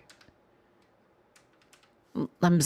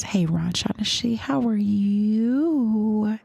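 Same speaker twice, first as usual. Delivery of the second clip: normal talking voice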